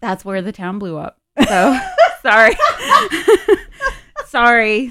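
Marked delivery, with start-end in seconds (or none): 1.5-4.9 giggling apology; laughing. Real, not acted. Laughing apology about spoiler.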